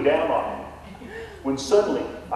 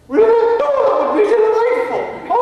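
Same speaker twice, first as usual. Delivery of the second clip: much higher, louder, thrilled fake English accent; delighted English ghost; 7 dB louder than usual. Ghost Nigel cheers at the realtor's news in a fake English accent. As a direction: ham up an overjoyed English gentleman. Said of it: "a Texan trying to emulate an Englishman".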